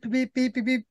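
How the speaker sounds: high-pitched little telegraph beeps; quick, high vocal beeping; imitated beeps. Shown as a demonstration. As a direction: beep out a wire-service signal in a high voice. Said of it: "imagine you hear the little beep".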